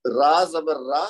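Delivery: each syllable drawn out slowly; teacher sounding out ra zabar ra; slow spelled syllables. Shown as a demonstration. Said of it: "یہ دیکھیے".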